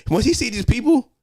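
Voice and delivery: sudden rise into mock alarm; panicky alarm. Retelling the dad spotting police, voice shooting up. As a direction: voice jumps high as panic hits.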